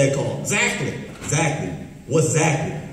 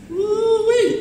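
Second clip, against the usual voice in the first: whooping Ooh-wee; 14 semitones higher than usual. Acted. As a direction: let out a big hollering whoop. Puppet's signature whoop.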